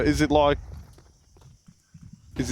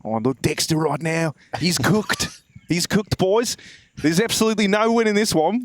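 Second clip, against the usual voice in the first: put-on Aussie caster voice; Australian accent play-by-play. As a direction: call the match like an Australian, stumbling over the vowels. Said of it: "playing in an accent must be very tough".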